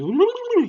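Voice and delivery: high, loud wordless gibber; deranged babble. Acted. Imitating Riddler out of his mind, high crazed babble. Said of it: "deranged. They're just out of his fucking mind".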